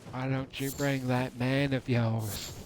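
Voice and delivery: ordinary talk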